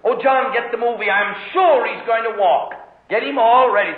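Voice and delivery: lightly voiced excited mom; excited mother. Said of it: "Mother says".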